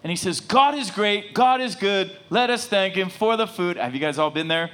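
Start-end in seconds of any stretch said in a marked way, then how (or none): 0.4-3.8 put-on high, loud kid reciting a prayer; praying kid. Six-year-old praying over the restaurant meal, high kiddie recital. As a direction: recite it like a small child saying grace. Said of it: "this kid's praying over the meal".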